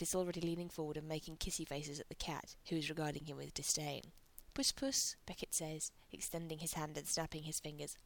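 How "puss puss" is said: cooing at a cat. Acted.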